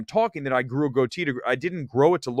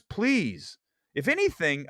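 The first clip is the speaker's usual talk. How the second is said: ordinary talk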